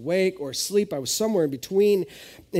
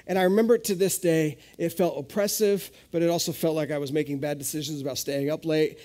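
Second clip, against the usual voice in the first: ordinary delivery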